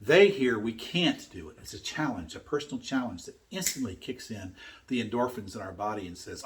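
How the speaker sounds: usual speaking voice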